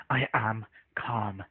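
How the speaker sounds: slow, quiet, strained imitation of someone losing patience; agitated, insisting calm. Retelling Puck's reply to being muted, slowly agitated. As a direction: imitate barely contained irritation, word by word. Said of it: "then he starts getting agitated".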